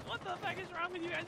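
high, indignant mock rant; imitated angry Karen. Karen turns on the staff, high imitated outrage.

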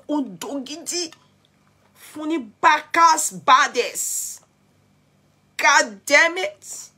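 high, loud, drawn-out exclamation; big, stretched, high-pitched outburst; hyped shout. Real, not acted. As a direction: belt it out slowly with relish. Hyped outburst over the scandal.

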